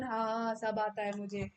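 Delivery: ordinary talk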